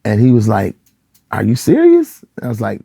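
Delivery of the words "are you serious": high, loud incredulous retort; heated disbelief. Retold or imitated. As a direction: imitate an upset pastor, pitched up in disbelief. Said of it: "He was very, very heated, upset".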